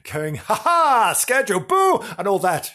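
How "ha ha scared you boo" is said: high, loud, gloating ghost; hammed-up spook shouting boo; taunting shadow man. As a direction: jump out and tease your victim. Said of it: "A shadow man rushing about".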